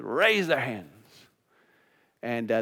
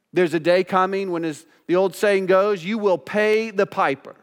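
forceful pulpit warning, key words punched; raised preaching voice, weighty and insistent; solemn preaching warning; 8 semitones higher, 7 dB louder, slower than usual. Acted. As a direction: warn the congregation of judgment.

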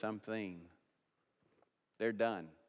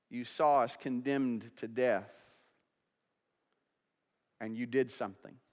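prayer; 3 semitones higher, 6 dB louder than usual. Acted. Leads prayer over music.

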